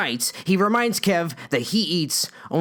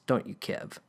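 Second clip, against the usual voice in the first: whisper; 7 semitones lower, 8 dB quieter than usual. Acted. Villain Buzz menaces Kev.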